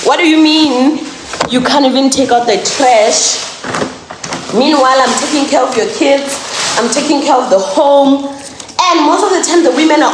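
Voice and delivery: loud, incredulous scolding of a husband; wife loudly scolds husband about the trash; indignant confrontation. Acted. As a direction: demand answers, loud and fed up.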